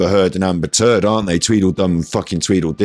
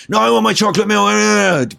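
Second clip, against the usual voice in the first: high-pitched whiny wail; mock protesting shopper; 12 semitones higher than usual. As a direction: wail it high like a thwarted customer. Acts out shopper thrown out by security.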